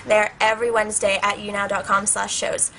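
ordinary talk

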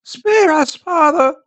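put-on high-pitched, imploring old woman's voice; old woman pleading. A narrator's reading. Old woman kneels before the angry priest, high plea. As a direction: beg high for mercy. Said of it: "She threw herself on her knees".

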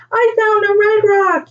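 put-on high-pitched, excited child's call; yelling out. A narrator's reading. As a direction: announce your find in a high shout. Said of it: "yelled out Della".

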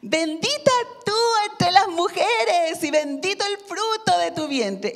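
high, loud, slow exclamation; loud joyful exclamation of blessing; exclaiming loudly. Shown as a demonstration. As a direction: cry it out with a great voice. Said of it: "O le dices".

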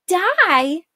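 high, loud startled cry; alarmed question. A narrator's reading. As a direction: gasp the word out in alarm. Arella alarmed at danger.

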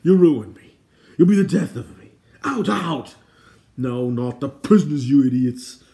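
high, very loud royal tantrum; overwrought, furious shouting; exasperated crying out. Acted. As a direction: bellow melodramatically at useless guards. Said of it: "King Edeleg cried, clasping his forehead".

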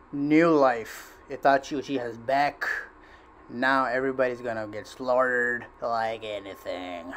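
ordinary talk, nothing marked

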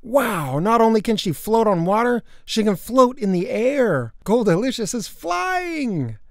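high-pitched, building wonder; amazed. Acted. Boy watching the pet fly, high amazed wonder.

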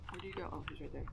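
ordinary talk

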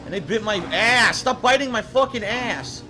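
loud angry yell at dogs. Real, not acted. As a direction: shout it out in frustration. Yelling at biting dogs.

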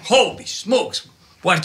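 high, slow, shocked "Holy smokes"; jolted by a sudden bang; startled exclamation. Retold or imitated. Reacting to the bomb bang.

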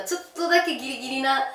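ordinary delivery

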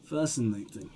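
low, soft mumble. Acted. Lieutenant mumbling quietly while studying the gun. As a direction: mumble it to yourself. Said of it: "then mumbles".

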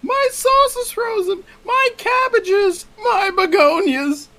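high, slow mock wail over lost crops; mock lament. Friend lamenting a spoiled game farm, high mock wail. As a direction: wail it like a ruined farmer.

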